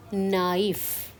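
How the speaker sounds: "knife" said as "na-if", the a and i kept apart; both vowels sounded. Shown as a demonstration. Teacher to students demonstrating naïf with separate vowels. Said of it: "we have to pronounce both the vowels separately".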